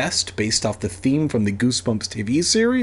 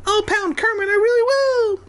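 much higher squeaky mouse voice; tiny mouse squeaks; 3 dB louder than usual. A narrator's reading. Shrunken boy threatens his brother in mouse squeaks. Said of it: "His voice came out as tiny as mouse squeaks".

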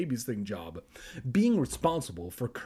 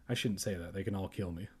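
much softer, lower muttered aside; sheepish quiet aside; 6 semitones lower, 9 dB quieter, faster than usual. Real, not acted. Narrator nervously walking back his joke, quiet. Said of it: "retreating aside".

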